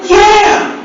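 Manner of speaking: high meek yes; mama voice. Retold or imitated. Answering the operator in high mama voice. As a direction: squeak it up high. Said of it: "mama voice".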